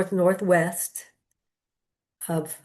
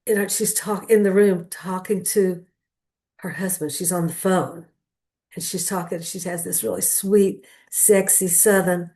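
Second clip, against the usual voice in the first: usual speaking voice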